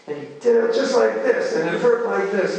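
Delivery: high, loud imitation of outrage; mad, upset sister. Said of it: "She was so mad".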